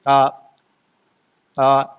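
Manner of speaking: ordinary talk, nothing marked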